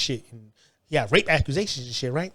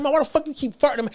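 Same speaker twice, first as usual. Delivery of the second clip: much higher, fed-up yelling tone; high exasperated complaint; 9 semitones higher than usual. Retold or imitated. Telling the cellmate to stop farting, exasperated and high. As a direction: throw it at him, pitched way up. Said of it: "I said".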